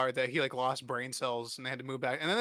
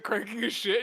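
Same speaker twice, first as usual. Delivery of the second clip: ordinary delivery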